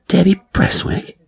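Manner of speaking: high, disbelieving question; asking the gossiping friend in sheer disbelief; incredulous. Acted. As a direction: shoot the name up in disbelief.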